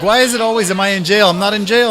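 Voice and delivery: exasperated protest. Retold or imitated. Son protesting to mom.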